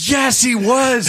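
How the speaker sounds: high, loud, slow offended snap; indignant retort. Retold or imitated. Pastor reading his colleague's notes, snapping back indignantly. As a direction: bristle like someone insulted your hero. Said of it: "All of a sudden, I'm like".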